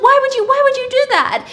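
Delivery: very high, loud wail; hammed-up hysterical demand; imitated screaming. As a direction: shriek the question in mock horror. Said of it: "screaming her face off".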